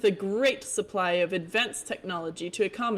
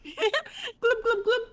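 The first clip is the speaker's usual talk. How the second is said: laughing glubs; much slower than usual. Acted. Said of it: "this voice more distinct".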